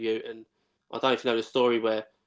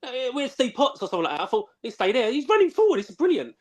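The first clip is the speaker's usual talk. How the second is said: higher, eager fan's thrill; excited; 10 semitones higher than usual. Retold or imitated. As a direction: relive a boy's wonder at a new hero. Retelling teenage excitement watching Seb.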